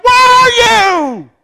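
very high, loud, stretched-out cry; acted wail of someone seeking God; desperate shout. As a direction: holler it up to heaven, dragging each word. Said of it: "You ever said it like that?".

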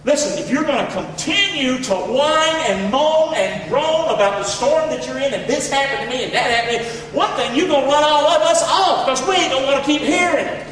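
rising, driving preacher scold; preaching at full force. Acted. Preacher warning the church against whining, building fervor. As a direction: press the congregation hard.

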